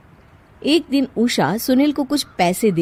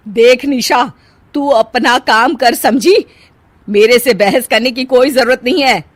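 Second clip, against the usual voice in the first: wicked stepmother; 4 semitones higher, 7 dB louder than usual. Acted. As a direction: warn her off, loud and deliberate. Mother-in-law threatening daughter-in-law.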